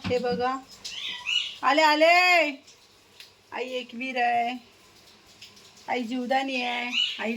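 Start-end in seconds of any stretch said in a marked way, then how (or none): 1.6-2.5 louder, drawn-out call back; real call across the house. Real, not acted. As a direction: holler that you're on your way. Calls out she is coming.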